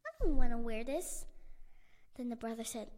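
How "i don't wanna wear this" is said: loud protest. Acted. Luna complains about uniform.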